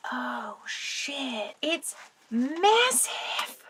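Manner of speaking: delighted disbelief, drawn out; stunned surprise. Real, not acted. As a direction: marvel at the size of the box. Opening a huge gift box.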